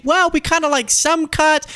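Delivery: very high, mocking waffle; mock waffling. Host parodying Democrats who waffle on cuts, high mocking voice. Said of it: "They're not saying".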